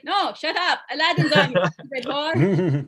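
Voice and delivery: loud, mock-angry dismissal; irritated dad. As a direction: shoo the kid away from the film. Dad snaps at interrupting child.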